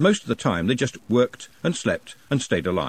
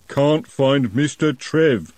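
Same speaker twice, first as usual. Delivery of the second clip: slow troll, a bit louder; 5 semitones higher, 5 dB louder, much slower than usual. A narrator's reading. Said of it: "the troll repeated, louder".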